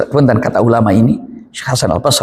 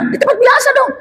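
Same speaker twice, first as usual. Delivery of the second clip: "Di tempat biasa dong" snapped much higher, exasperated; snapping reply. Retold or imitated. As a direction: bark "biasa dong" at the kid. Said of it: "Kenapa harus ngegas gitu". ("Di tempat biasa dong" dengan nada tinggi membentak)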